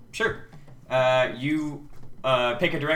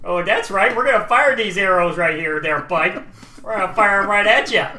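loud, raised taunting warning; hammed-up cocky guard; blustering threat; 6 semitones higher, 6 dB louder than usual. Second guard keeps threatening.

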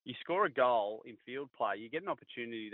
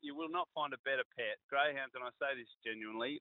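ordinary talk, nothing marked

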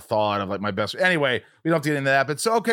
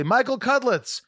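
announcer call; 11 semitones higher, slower than usual. Pretend. Announcing name at convention.

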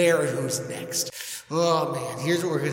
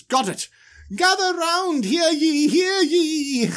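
town crier Aaron; 13 semitones higher, 4 dB louder, slower than usual. Retold or imitated. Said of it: "And he goes".

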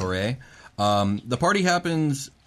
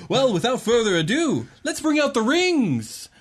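booming prize announcer; 13 semitones higher, 3 dB louder than usual. Acted. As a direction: draw out the big reveal. Goblin announcer presents the rings.

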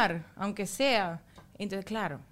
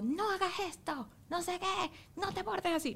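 higher, softened mock telling-off; mock scolding; 8 semitones higher, 9 dB quieter than usual. Mother showing scolding that makes son cry more, mock scolding. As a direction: nag in a raised pitch without volume. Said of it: "me pongo".

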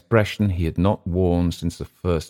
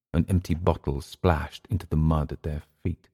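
ordinary delivery, nothing marked

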